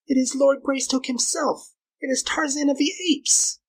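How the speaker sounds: very high, anxious female voice; urgent hiss. A narrator's reading. Girl identifying Tarzan to her companion, urgent alarm. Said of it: "hissed the girl".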